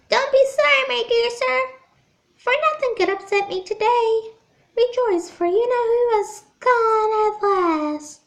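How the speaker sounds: put-on high squeaky little old man. A narrator's reading. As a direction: squeak it out, overjoyed. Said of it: "in a squeaky voice".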